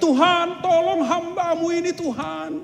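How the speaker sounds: high-pitched pleading call to God; desperate cry. Acted. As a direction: cry out for rescue, stretched. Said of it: "Kemudian dia berteriak". (orang saleh berseru putus asa kepada Tuhan)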